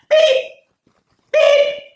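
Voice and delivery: very high, loud beep-beep; truck beep. A narrator's reading. Reading the truck's horn to children, very high and loud. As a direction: honk like a toy truck. Truck sound in picture book.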